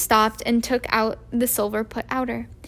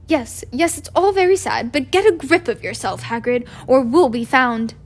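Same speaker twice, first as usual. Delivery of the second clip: stern McGonagall; 5 dB louder than usual. Acted. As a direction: scold him to pull together. McGonagall snapping at crying Hagrid.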